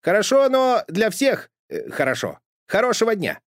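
high flustered Pechkin; hurried Pechkin. A narrator's reading. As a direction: rush the goodbye as a flustered postman. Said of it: "вдруг заторопился Печкин".

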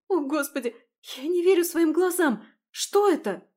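high, loud shocked cry; shocked shout. Acted. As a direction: shriek in disbelief. Ужас перед зеркалом. (потрясённый крик)

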